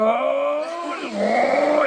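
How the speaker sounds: high, drawn-out gasp of alarm; mock shocked "oh". Visitor as the monk opens the door, mock shocked cry.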